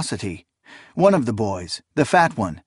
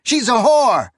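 shouted accusation; 11 semitones higher, 5 dB louder than usual. A narrator's reading. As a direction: yell it to the crowd.

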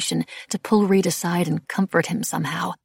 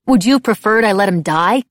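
loud, raised-pitch challenge; defiant; 4 semitones higher, 9 dB louder than usual. A narrator's reading. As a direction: throw it back, chin lifted. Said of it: "I lifted my chin defiantly".

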